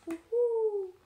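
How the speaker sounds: high, loud hooting; owl hoot. Acted. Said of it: "asked the owl".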